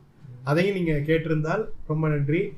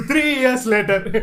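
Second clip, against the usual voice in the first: slow dramatic announcement; 4 semitones higher, much slower than usual. Pretend. Movie title card joke.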